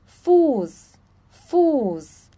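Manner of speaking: "Fuß" ending in a clear S. Shown as a demonstration. Teacher giving examples of ß, "Fuß" ending in S. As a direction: say "Fuß" as "fus", with a long U. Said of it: "Ali ele tem som de S".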